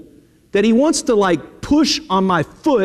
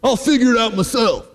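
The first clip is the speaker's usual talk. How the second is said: cocky youth. Retold or imitated. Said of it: "problem with the younger generation".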